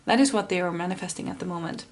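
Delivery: ordinary talk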